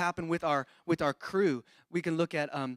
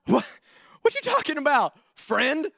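sharp, high, louder outraged question; indignant disbelief; 9 semitones higher, 4 dB louder than usual. Pretend. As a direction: react like you can't believe what he said. Pastor reacts to friend's harsh words.

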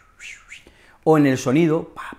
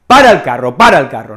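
loud "ey, ey" to cut someone off, then a firm hush; calling someone to stop; 7 dB louder than usual. Shown as a demonstration. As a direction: rein in someone who's getting worked up, calling it out loud. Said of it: "En esas situaciones podemos decir". (llamando a alguien a calmarse, en voz alta y firme)

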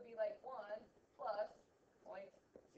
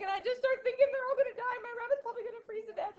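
imitating a crying colleague; 10 semitones higher, 7 dB louder, faster than usual. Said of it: "And she was crying".

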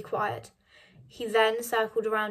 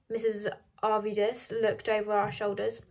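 usual speaking voice

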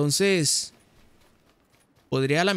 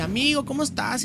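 imitating her tone; 7 semitones higher than usual. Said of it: "siempre me hablaba así en ese tono".

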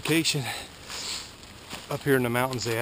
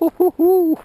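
high, loud thrilled yell; excited shout; 10 semitones higher, 13 dB louder than usual. Real, not acted. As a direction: shout in real amazement at the jump. RC car makes a wild jump.

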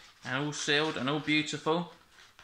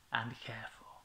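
whispered; 4 semitones lower, 9 dB quieter than usual. A narrator's reading. Story ending fades off.

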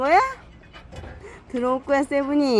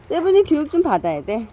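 ordinary talk, nothing marked

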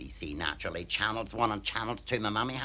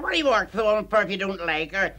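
mock scolding; 7 semitones higher, 5 dB louder than usual. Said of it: "She said".

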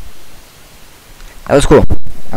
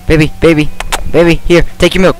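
raised, pleading voice; urgent calling; 7 semitones higher, 4 dB louder, faster than usual. Real, not acted. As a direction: call out anxiously, pitched up. Calling to the game's baby.

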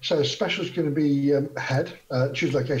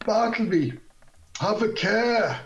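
called-out warning; 8 semitones higher than usual. Acted. Player as Hazra calls to Bartleby, restrained shout. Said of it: "shout out".

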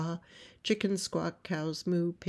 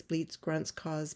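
usual speaking voice

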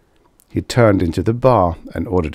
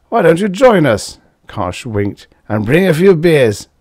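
a somewhat louder, higher tipsy loudmouth; Karsh, tipsy braggart; 7 semitones higher, 5 dB louder than usual. A narrator's reading. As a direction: play the boozy blowhard at the bar. Karsh voiced in story.

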